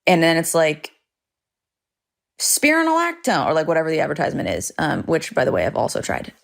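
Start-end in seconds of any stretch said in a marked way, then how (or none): 2.4-3.5 very high, sing-out advert name; cheery advert voice. Acted. Woman on a drug poster pitching it, high cheery ad voice. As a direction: chirp it like a poster model.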